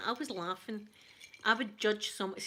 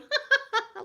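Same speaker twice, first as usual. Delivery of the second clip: squealing laugh, much higher and louder; high burst of laughter; 9 dB louder than usual. Shown as a demonstration. Showing how she giggled watching the spider, high squealing laugh. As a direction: let a suppressed giggle escape high. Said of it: "like that".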